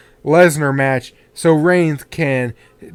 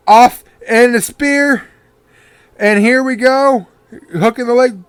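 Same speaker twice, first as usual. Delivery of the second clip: wrestling commentator; 6 semitones higher than usual. Acted. Calling the finish live.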